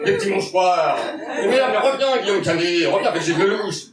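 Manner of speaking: exasperated raspy-edged Cluzet outburst; a stand-up doing a loud, fed-up Cluzet; François Cluzet impression. Comedian on stage, impersonating François Cluzet, exasperated. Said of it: "je me sens obligé de dire le nom de la personne que j'imite".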